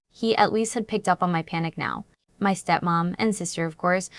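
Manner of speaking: usual speaking voice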